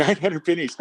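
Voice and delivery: can't buy that story; amused disbelief. Retold or imitated. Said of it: "And I'm thinking".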